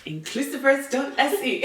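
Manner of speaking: chuckling as you echo the address; laughing repeat. Real, not acted. Playful repeating of the web address.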